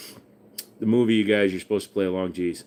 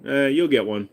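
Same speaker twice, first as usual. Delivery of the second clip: normal talking voice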